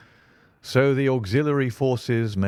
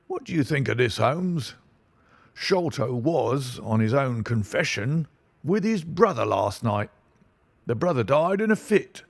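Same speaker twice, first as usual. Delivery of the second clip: pompous inspector; 6 semitones higher than usual. Acted. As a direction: talk down to him with bluster. Inspector lecturing and theorizing.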